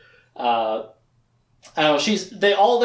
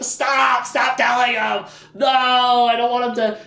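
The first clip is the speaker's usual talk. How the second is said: high-pitched wailed protest; mock desperate pleading; 8 semitones higher, 4 dB louder than usual. Captive begs witch to stop telling him, mock desperate. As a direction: imitate a frantic plea, hammed up.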